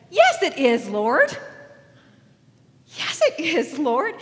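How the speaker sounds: loud, lifted voice, rising at the end; bold comeback. Acted. Woman answering Jesus back, bold comeback. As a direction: push it out as a spirited retort.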